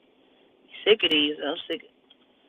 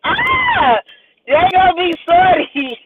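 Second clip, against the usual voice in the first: loud and much higher, crowing; cackling triumph, loud and high; gleeful shout; 10 semitones higher, 5 dB louder, slower than usual. Real, not acted. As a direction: whoop it out in glee. Gleeful outburst at prank idea.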